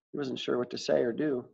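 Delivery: ordinary delivery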